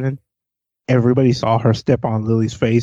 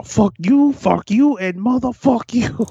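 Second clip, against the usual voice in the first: building emphatic cursing; 11 semitones higher, slower than usual. Real, not acted. Escalating joke curse.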